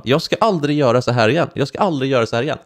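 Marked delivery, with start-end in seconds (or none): 1.5-2.6 fast, rushed repeat of the line; rattled off fast. Retold or imitated. Copying punishment lines after school, rushed and fast. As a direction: gabble it like a copied punishment line. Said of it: "sitta och skriva".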